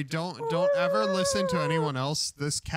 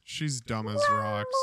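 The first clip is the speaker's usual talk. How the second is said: mock outrage. Real, not acted. Repeating insult at cat, louder.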